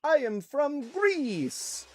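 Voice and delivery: slow, high mock Greek accent; imitating a Greek introducing himself, drawn out; put-on Greek accent. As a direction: stretch it out in a hammed-up Greek accent. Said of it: "they would say it in their accent".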